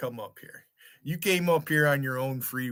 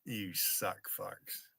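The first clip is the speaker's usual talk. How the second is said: muttered insult; 7 semitones lower, 18 dB quieter, much slower than usual. Real, not acted.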